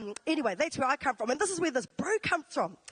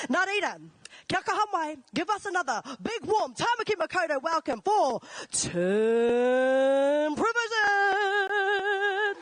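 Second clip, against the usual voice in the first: big drawn-out announcer call, slow and louder; hype announcer intro; 5 semitones higher, 4 dB louder, slower than usual. Real, not acted. MC hyping the crowd to welcome the comedian, slow booming announcer call. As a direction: stretch the name out like a ring announcer.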